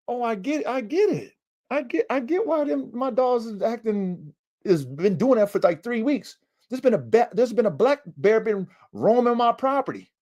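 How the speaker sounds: high-pitched retold realization; dawning realization. Owner working out why dogs barked, high-pitched realization. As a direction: let it all click, way up high.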